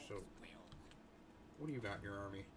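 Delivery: normal talking voice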